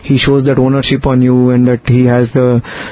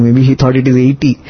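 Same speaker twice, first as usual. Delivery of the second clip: normal talking voice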